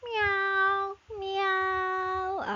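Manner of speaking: high mewing cat voice; cat meow. Pretend. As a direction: meow like a playful kitten. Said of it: "The cat says".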